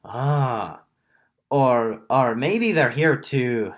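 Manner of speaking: put-on tentative go at the Warforged's accent; missed accent attempt. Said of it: "then the Warforged says".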